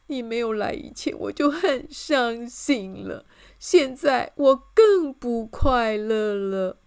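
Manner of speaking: slow, drawn-out tearful complaint; crying again. A narrator's reading. King complains of his unhappiness, crying. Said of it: "又哭起来了".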